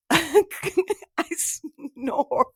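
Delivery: halting, giggly confession; laughing admission. Real, not acted. Listing her sleep noises to co-host, laughing admission. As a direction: laugh breaking into the words.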